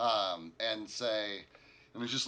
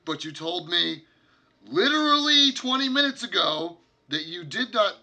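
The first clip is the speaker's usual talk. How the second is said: rising, louder exasperation; frustrated leader; 5 semitones higher, 7 dB louder than usual. Acted. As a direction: build from weary to fed up. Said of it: "he's like".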